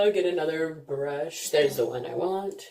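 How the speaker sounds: ordinary talk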